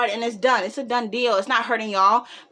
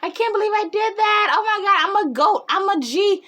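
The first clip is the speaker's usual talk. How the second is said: very high, giddy bragging; laughing, gloating friend; 9 semitones higher, 4 dB louder than usual. Retold or imitated. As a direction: imitate a friend crowing over a win. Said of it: "she's, like, laughing on her way home".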